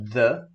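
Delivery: "the" said as thuh, toward O; short "the" like thuh; the with schwa. Shown as a demonstration. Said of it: "Nós temos o som".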